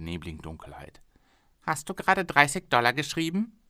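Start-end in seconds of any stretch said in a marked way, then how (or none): 1.6-3.6 higher, louder, stress on gerade; startled question. Acted. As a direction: catch him lowballing you. Owner surprised at low bid.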